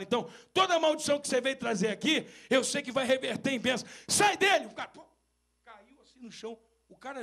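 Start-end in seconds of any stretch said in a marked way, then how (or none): 0.0-4.7 louder, faster, ending in a shouted command; rising rebuke. Retold or imitated. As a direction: build to a shout against Satan. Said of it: "ele vira assim e fala".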